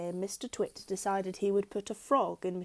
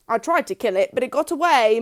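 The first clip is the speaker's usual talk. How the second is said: louder gruff Mister Twit; gruff husband; 4 semitones higher, 11 dB louder than usual. A narrator's reading. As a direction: play up the danger. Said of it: "Mister Twit said".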